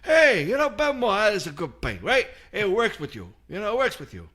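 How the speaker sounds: imitating the painter. Mocking the painter to a friend, gushing and high. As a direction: gush about the paint like the painter did. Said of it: "and everything was".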